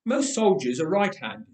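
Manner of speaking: plain reading voice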